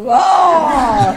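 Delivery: a loud, high, lone cheer; cheering shout. Retold or imitated. The only fan cheering for the wrong team, loud and high. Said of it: "Y yo allí chillando".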